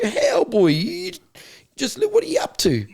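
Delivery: high, mocking greeting to a returning boy; teasing guard. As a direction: call out like a guard seeing him back again. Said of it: "you'd be like".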